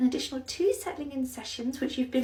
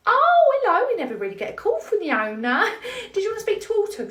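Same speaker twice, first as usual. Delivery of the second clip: gushing phone voice; 9 semitones higher, 7 dB louder than usual. Acted. Sketch, nursery manager on phone.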